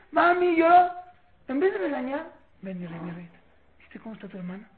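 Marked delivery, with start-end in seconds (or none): 0.1-0.9 loud, high cry to mum; child's complaint. Acted. Child to mother during a sibling squabble, loud complaint. 2.6-4.7 soft, low coaxing parent; gentle correction. Acted. Parent to child who hurt a sister, gentle coaxing.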